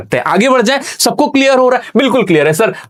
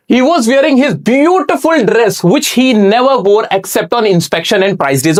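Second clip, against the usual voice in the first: slow textbook reading; 3 semitones higher, much slower than usual. Shown as a demonstration. Teacher reads the English text to students, slow and deliberate. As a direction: read each English word out slowly and clearly.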